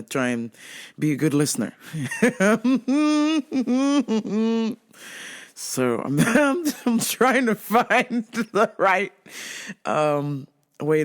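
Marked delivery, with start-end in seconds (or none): 1.8-4.7 awkward laugh; uneasy laughter; nervous laugh. Real, not acted. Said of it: "uncomfortable laugh". 6.2-9.1 nervous laugh. Real, not acted. Awkward laughter while talking.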